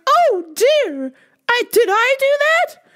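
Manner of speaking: very high, worried lady voice; high fretful old lady. Retold or imitated. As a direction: fret like the guilty lady. Lady's dismay, high.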